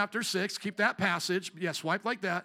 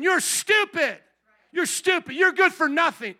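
harsh belittling parent; 8 semitones higher, 8 dB louder than usual. Retold or imitated. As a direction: tear the child down with scorn. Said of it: "another way a parent could be saying".

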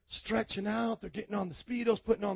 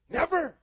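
"Never." thrown up high and hit hard; stressed never; 8 semitones higher than usual. Real, not acted. Preacher repeating God's answer, hitting "Never." high. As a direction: land "Never." as one sharp high word. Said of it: "emphatic repeat of never".

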